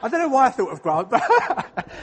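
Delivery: laughing while speaking; words broken up by a chuckle. Real, not acted. Laughing at his own memory.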